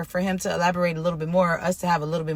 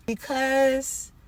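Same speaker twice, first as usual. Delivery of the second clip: usual speaking voice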